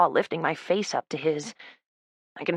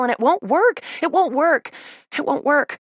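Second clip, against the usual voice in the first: desperate; 9 semitones higher, 5 dB louder than usual. Acted. Desperate repetition.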